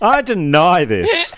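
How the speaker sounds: slow, laughing protest; laughing denial. Real, not acted.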